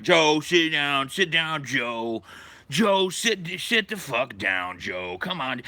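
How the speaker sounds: slow, urging sit-down plea; pleading members. Retold or imitated. Members urge Joe to sit down, slow urging plea. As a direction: coax him back into his chair.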